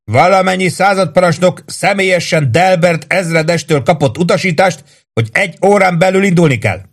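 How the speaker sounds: high, stern barked orders; angry commands. A narrator's reading. Lieutenant angrily ordering the sergeant off the roof. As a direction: order him down, losing patience.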